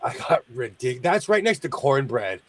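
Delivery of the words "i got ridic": ordinary talk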